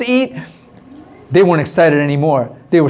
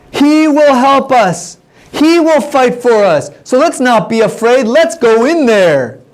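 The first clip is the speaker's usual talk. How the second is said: slow, raised-pitch proclamation, word by word; declaring; 5 semitones higher, slower than usual. Retold or imitated. Caleb urging the people into Canaan, slow declaration. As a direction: proclaim it slowly to rally a whole crowd.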